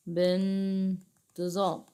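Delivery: بانتظام slowly stretched, pausing on the first syllable; drawn-out word. Shown as a demonstration. Teacher repeating the answer for pupils, drawn-out word. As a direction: sound out بانتظام slowly and softly.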